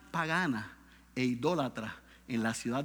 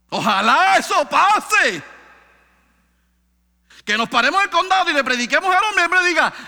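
high, loud fervent preaching; preaching at full force; 11 semitones higher, 13 dB louder than usual. Acted. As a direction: cry it out to the congregation. Preaching climax.

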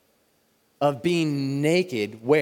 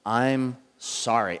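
slow sorry; 3 semitones lower, much slower than usual. Shown as a demonstration. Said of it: "And I was".

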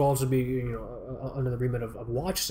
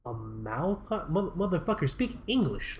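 baffled scorn; 6 dB quieter than usual. Retold or imitated. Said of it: "And I'm like".

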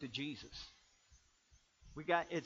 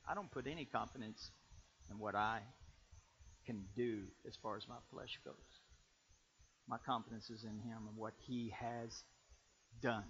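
low, soft preacher's quoting; hushed scripture quotation; 6 semitones lower, 8 dB quieter than usual. Acted. Preacher quoting Paul on confidence to congregation, hushed scripture quotation. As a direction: bring it right down, quiet and measured. Reads out Paul's words.

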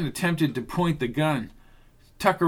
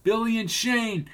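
yelling; 7 semitones higher than usual. A narrator's reading. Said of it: "Catherine yelled from below".

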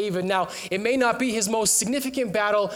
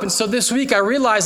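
normal talking voice

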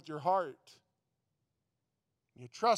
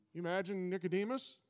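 normal talking voice

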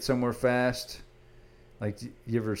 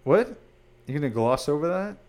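high, loud disbelieving what; surprised; 7 semitones higher, 6 dB louder than usual. Real, not acted. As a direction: caught off guard, pressing him. Auctioneer secret revealed, disbelief.

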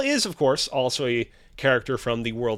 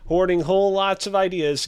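ordinary delivery

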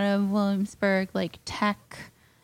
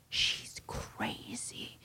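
hushed, breathy whisper under the breath; whispered aside; 15 dB quieter, much slower than usual. Retold or imitated. Tech guy whispering to the speaker about the girls. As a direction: whisper it so the girls can't hear. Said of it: "He goes".

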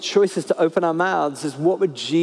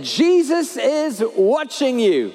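slow, slightly higher mysterious voice; eerie disembodied voice; 6 semitones higher, slower than usual. Acted. Hidden parrot warns the burglar, slow eerie voice. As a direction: spook the burglar from the dark. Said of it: "the voice says again".

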